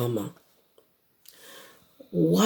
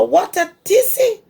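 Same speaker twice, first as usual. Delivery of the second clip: much higher mock exasperation; mock exasperated; 14 semitones higher, 3 dB louder than usual. Mama chides baby llama, higher exasperated. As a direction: tut at the tantrum.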